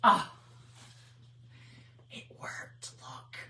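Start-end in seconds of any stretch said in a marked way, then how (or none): 2.1-3.4 awed whisper; stunned whispered relief. Real, not acted. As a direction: whisper it, amazed. Whispered surprise.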